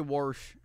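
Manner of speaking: "warsh" with an r before the sh; intrusive r. Shown as a demonstration. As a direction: say wash as "warsh". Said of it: "I do say warsh".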